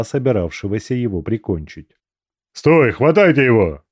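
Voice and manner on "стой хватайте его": barked command, louder; sharp order. A narrator's reading. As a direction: order them to grab him. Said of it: "приказал Войс".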